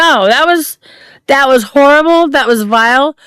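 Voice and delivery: loud, indignant outcry; loud outrage. Real, not acted. Cohost reacts loudly to vile remark.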